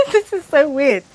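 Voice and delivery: high, tearful voice with a laugh; laughing through real tears; weepy and embarrassed; crying. Real, not acted. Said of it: "I'm crying".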